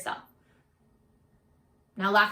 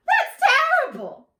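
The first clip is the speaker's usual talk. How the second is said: very high shocked yelp; startled horror on the first word; mock-horrified squeal; shocked gasp; 5 dB louder, faster than usual. Shock at wolf's words.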